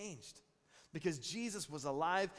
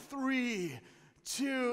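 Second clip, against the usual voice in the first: raised, teasing countdown; dad counting down; 7 semitones higher, 6 dB louder than usual. Retold or imitated. Dad counting down after already pulling the tooth, raised. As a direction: count high and loud like it's for real. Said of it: "And I go".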